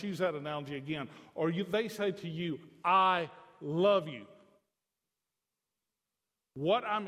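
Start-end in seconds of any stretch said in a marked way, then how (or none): 2.8-4.3 slow, weighted "I love you"; emphatic declaration. Retold or imitated. Children speaking to parent, quoted with slow emphasis. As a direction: stretch out "I" and "love", each weighted.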